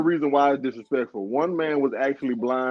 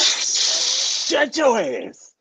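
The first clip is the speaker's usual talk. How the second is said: slow mock threat; 11 semitones higher, much slower than usual. Real, not acted. Teasing about age.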